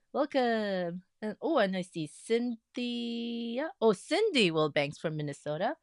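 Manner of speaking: usual speaking voice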